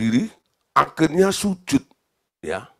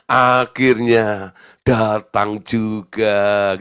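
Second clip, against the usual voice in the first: very slow, drawn-out words; slow sigh of relief in words; relieved at last; 4 semitones lower, much slower than usual. Acted. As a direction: stretch every syllable in long-awaited relief. Voicing the woman's relief at last.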